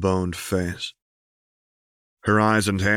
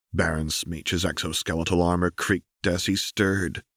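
plain reading voice

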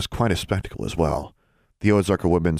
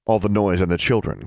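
plain reading voice

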